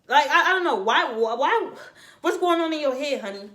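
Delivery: exasperated. Real, not acted. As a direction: disbelief pushed up with a laugh.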